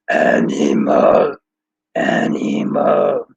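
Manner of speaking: rough, low Muppet monster, drawn out; throat-straining low creature voice; Animal. Acted. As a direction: growl it slowly like a wild puppet. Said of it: "Animal was my very favorite Muppet because he would say".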